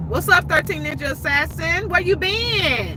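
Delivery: high, drawn-out call-out; excited greeting. Real, not acted. Host greeting a returning viewer, high and excited. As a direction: hail a long-missed regular.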